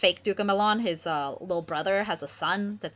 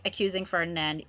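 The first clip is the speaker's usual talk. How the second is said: ordinary delivery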